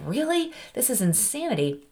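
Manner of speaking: high incredulous "really", then dropping; exasperated disbelief. Retold or imitated. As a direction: question it in disbelief, pitch jumping then falling. Retelling exasperation with the agent.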